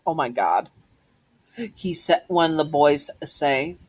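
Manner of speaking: usual speaking voice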